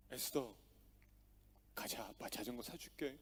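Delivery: calm her down gently; dad softly comforts his crying daughter; soothing dad. Retold or imitated.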